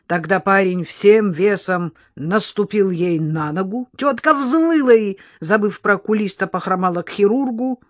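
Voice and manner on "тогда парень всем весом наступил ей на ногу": plain reading voice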